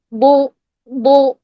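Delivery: بو drawn out slow and heavy; full-mouthed ضو. Shown as a demonstration. Teacher shows what to practise, slow full-mouthed ضو. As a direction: fill the mouth and hold بو. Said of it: "منہ بھر کے".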